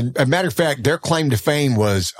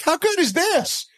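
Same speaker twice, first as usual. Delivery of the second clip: retold delight. Football fan retelling watching both his teams play, delighted. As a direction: marvel at it with the voice leaping up.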